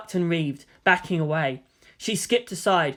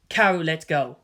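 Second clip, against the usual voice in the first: ordinary delivery, nothing marked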